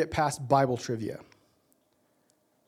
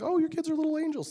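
high, sugary mock voice on "Oh, your kids are little angels"; mock sweet; 12 semitones higher, faster than usual. To the congregation, mocking the doting-parent cliché, high sugary voice. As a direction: parody the doting parent, pitched way up.

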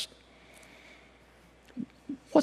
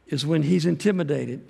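ordinary delivery

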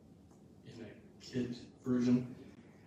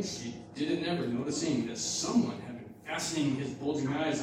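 lively, sing-song storytelling to the congregation; rhyming story read aloud; 2 semitones higher, 4 dB louder than usual. Acted. Pastor reading a kids' rhyme to the church, lively storytelling. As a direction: read the rhyme with bounce and drama.

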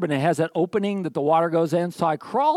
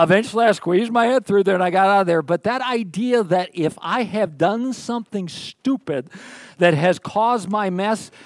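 normal talking voice

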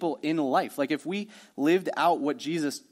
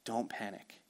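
quiet, lower, soothing; soft calm reassurance; 5 semitones lower, 12 dB quieter than usual. Acted. Preacher quoting Jesus to disciples, quietly reassuring. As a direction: ease them down with a hush.

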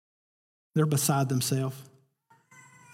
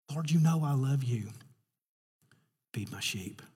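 hushed dialogue; 5 dB quieter than usual. Acted. Jesus and Peter dialogue, hushed.

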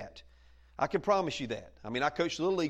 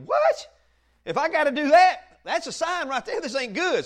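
high, loud "What?" of someone caught off guard; shocked disbelief; 9 semitones higher, 8 dB louder than usual. Retold or imitated. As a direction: blurt it out as if you can't believe it. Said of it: "I'm thinking".

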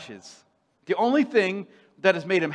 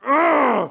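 loud, higher frustrated "Ugh!"; furious groan; 8 semitones higher, 8 dB louder than usual. Acted. Jonah too furious for words, loud angry groan. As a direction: let out a wordless burst of rage. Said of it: "he's furious".

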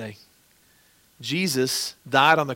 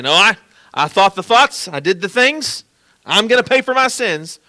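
higher and louder, hammed-up protest; mock proud refusal; 6 semitones higher, 9 dB louder than usual. Pastor mocking someone refusing forgiveness, loud and high. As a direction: insist on paying your own way, overdone.

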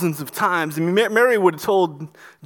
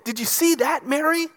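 higher, eager question; amazed husband; 7 semitones higher than usual. Retold or imitated. Joseph asking Mary, eager and higher. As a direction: lift it in wonder. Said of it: "maybe he said to Mary".

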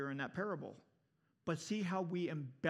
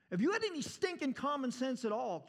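angry retort; 6 semitones higher than usual. Acted. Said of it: "here's his response".

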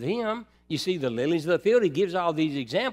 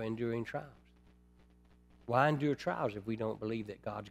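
quiet, lower, slowed reflective preaching; soft pastoral preaching; 5 semitones lower, 6 dB quieter than usual. Acted. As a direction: draw the flock in gently. Quiet intimate preaching passage.

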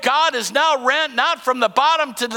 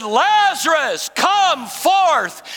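high, drawn-out commanding shout; loud voice; 7 semitones higher, much slower than usual. Acted. Preacher voicing the call to Lazarus at the grave, loud voice. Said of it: "In a loud voice".

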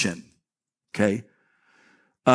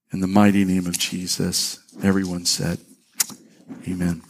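prayer. Acted. Pastor leads a prayer.

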